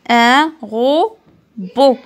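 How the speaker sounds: "Un robot" stretched very slowly and loud, ro-bot; drawn-out syllables. Shown as a demonstration. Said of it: "Bo, مع التي".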